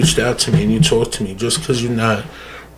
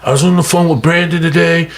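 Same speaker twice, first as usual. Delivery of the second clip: high, showy bragging voice, pitched well up; fake-humble brag, pitched up high; mock bragging; 7 semitones higher than usual. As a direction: ham up a show-off posting about his good deeds. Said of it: "So what I would do is I go".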